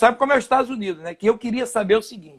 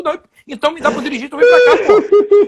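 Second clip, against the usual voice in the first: mock-angry shout, much higher and louder, laughing through it; angry retort; 7 semitones higher, 5 dB louder than usual. Arguing with his teammate over the map, mock-angry laughing shout. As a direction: snap back at the friend, half laughing. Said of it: "o estresse já começou a porrada ali".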